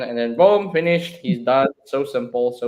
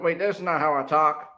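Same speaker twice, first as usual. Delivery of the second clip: ordinary delivery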